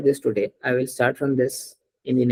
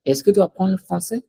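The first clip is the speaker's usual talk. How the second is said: "Est-ce que tu apprends le français?" higher, rising on "français"; voice raised at end; 4 semitones higher than usual. Shown as a demonstration. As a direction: lift "français" at the end as a question. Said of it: "raise a voice at the end".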